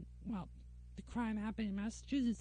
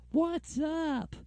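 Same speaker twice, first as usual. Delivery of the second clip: a louder, higher, hammed-up Texan drawl; mock Texan greeting; 5 semitones higher, 7 dB louder than usual. Mocking Southerners, a Texan-accent what's up. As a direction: greet them like a cartoon Texan. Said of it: "Y'all talking like a Texan".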